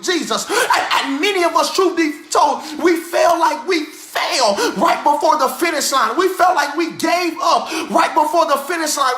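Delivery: rhythmic preacher cadence, shouted peaks; full-force preaching. Acted. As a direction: preach it like an old Baptist preacher. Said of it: "I felt the old Baptist preacher rise up in me".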